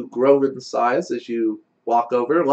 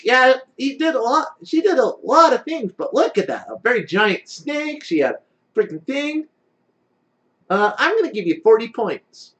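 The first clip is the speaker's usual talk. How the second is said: impressed judge; 8 semitones higher than usual. Acted. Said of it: "Karma's also like".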